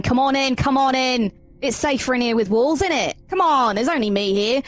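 high, slipping Cockney voice that drifts Australian; Cockney attempt. Character beckons the others inside, slipping Cockney accent. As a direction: call them in like a cheeky Londoner. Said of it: "going back to Australian again".

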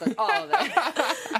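laughing through the words; giggling burst. Real, not acted. Laughing at own excitement.

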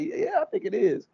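hammed-up girlish surprise; high mock gossip. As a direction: gasp it like juicy gossip. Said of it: "He like".

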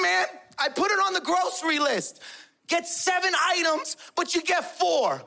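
high-pitched exasperated wife's tirade; exasperated scolding. Retold or imitated. Mother scolds father over groceries.

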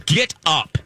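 sharp order, said again; stern command. Retold or imitated. Daughter repeating her order to the woman blocking. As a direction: insist hard, no arguing. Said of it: "I go".

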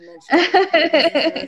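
real laughter while talking; laughing. Real, not acted. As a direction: chuckle as you admit it. Host laughing at own remark.

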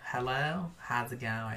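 hushed mimicking voice; mock impression of friend. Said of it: "That's how it sounds to you?".

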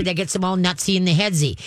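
normal talking voice

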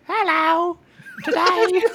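high, put-on Cockney-ish British voice; mock Andrew Tate. Mocking Andrew Tate to podcast hosts, hammed-up British accent. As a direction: ham up a silly British tough-guy accent. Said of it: "If Andrew Tate was like".

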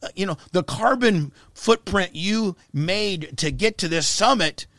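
high and loud, ranting at her; retold rant. Ranting at the teen activist about her jet, loud and high. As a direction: scold her over her carbon footprint, loud. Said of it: "like, like".

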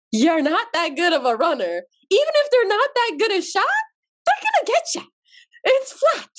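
high-pitched exasperated plea; exasperated cousin. Retold or imitated. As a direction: talk her out of running, exasperated. Cousin warning her not run.

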